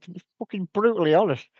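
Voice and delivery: blunt retold host. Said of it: "He said".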